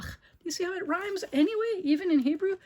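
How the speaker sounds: high, bright-eyed question. Real, not acted. Asks child excitedly.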